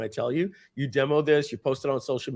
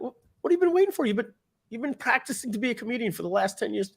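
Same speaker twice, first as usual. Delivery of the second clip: much higher, fast, put-on voice; acting out pushy sitcom brother; 10 semitones higher, faster than usual. Charlie pressing his brother, high fast voice. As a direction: needle him, fast and high.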